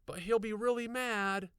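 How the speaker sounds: put-on high girl's pleading voice. A narrator's reading. Girl pleading with her friend, pleading voice. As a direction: beg him to wait. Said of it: "Aaron pleaded".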